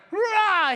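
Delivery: loud, high strongman roar; mighty roar. Retold or imitated. Jacob rolling away the stone, loud roar. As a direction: bellow as if lifting a boulder. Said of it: "it's like".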